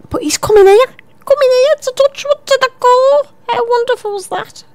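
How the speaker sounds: very high, excited squeal of a voice; gushing excitement. Pretend. Presenter gushing to listeners about a guest coming, excited.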